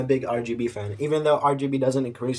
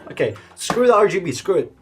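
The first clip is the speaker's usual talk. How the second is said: excited; 7 semitones higher, 8 dB louder than usual. Real, not acted. Dismissing RGB, eager.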